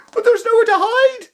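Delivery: high, loud desperate shout. Acted. Lily shouting in panic that there's nowhere to hide. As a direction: cry out there's no escape. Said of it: "Lily shouted".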